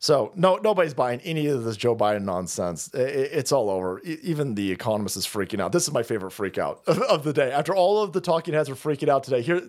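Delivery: normal talking voice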